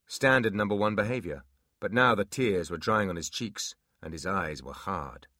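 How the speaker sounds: ordinary delivery, nothing marked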